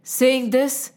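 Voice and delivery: plain reading voice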